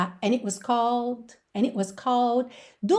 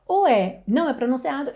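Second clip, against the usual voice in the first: normal talking voice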